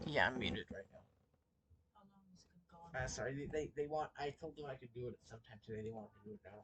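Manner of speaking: speaking softly away from the mic; quiet. Real, not acted. Muted, talking to mom off-mic.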